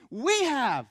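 loud, high preacher's retort; full-force preaching. Real, not acted. Preacher answering a rhetorical question, full-force preaching. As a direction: punch it out from the pulpit.

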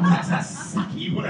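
loud breathy laugh-whisper, voice cracking. Retold or imitated. Pastor confessing his disappointment, laughing breathily. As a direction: confess the letdown through laughter. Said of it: "inside I'm going".

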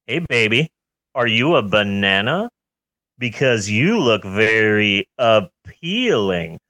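cheesy pickup line. Acted. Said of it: "here's another one".

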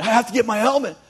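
high, loud desperate cry; frantic yelling. Acted. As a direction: shout it like he'll die without it. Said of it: "he starts yelling".

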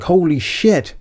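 high, startled panic; a panicked local, hammed up; mock panic. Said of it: "froze in panic. They're like".

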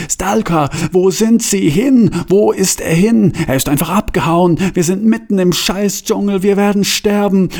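high-pitched, panicked calling; desperate cries for help. A narrator's reading. Two sinking men call after the vanished Stalker, in high desperation. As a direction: call out high and desperate, without full shouting. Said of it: "so verzweifelt waren sie".

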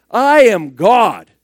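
preacher booming out Jesus' claim, slow and loud; slow emphatic declaration. Acted. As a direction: proclaim each word slowly and loudly.

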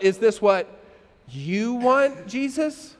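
YOU held long and set apart; slow question that leans on YOU; stress on YOU. Acted. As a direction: ask it with weight on YOU, lifting at Jesus. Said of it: "Not what I want".

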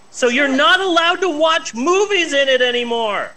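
high-pitched incredulous complaint; exasperated disbelief. Real, not acted. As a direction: push the absurd rule out in high exasperation. Exasperated at absurd rezoning rule.

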